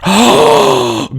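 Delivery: high dramatic gasp; mock gasp. Real, not acted. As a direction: gasp in shock. Gasp at killed father line.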